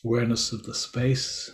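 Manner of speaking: measured meditation guidance with long, silent pauses; guided meditation. Real, not acted. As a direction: leave space after each phrase, guiding listeners into body awareness. Leads a silent-day meditation.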